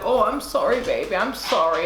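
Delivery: mock apology. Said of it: "he'd be like".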